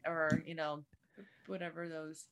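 normal talking voice

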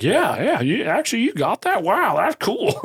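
high, impressed client reaction; imitated amazement. Speaker mimicking clients who got a simple explanation, impressed.

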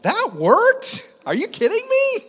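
very high, loud incredulous squeal; hammed-up astonishment, voice shooting way up; acted-out disbelief. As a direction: squeak it out in comic amazement. Amazed inner reaction, acted out.

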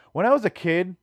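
ordinary talk

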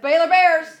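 loud, high whoop for his team; rowdy sports cheer. Real, not acted. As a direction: shout it like a fan in the stands.

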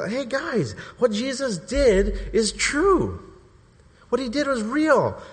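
high, drawn-out announcement; imagined proclamation. Retold or imitated. Peter imagined telling the disciples, high proclaiming. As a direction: proclaim the big news to friends. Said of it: "tell the other disciples and say".

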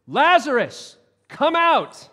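mighty shout raising the dead; commanding shout. Acted. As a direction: call him out of the tomb with authority. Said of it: "And then Jesus shouted".

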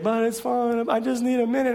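put-on a boy pleading for just a minute; son's voice. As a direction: play the panicking nine-year-old begging for time. Son voiced in retold story.

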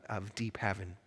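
ordinary delivery

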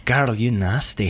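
slow, low mock-sultry tease; impressed lover. Acting his reply to her, sliding low and slow. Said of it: "And he's like".

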